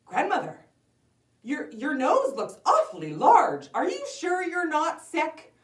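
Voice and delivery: put-on high, loud, halting girl voice; concerned little girl. Girl worried about grandmother.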